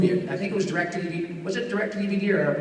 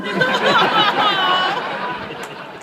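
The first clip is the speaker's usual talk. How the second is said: high wheezy cackle; 14 semitones higher, 5 dB louder than usual. Retold or imitated. Buttram laugh.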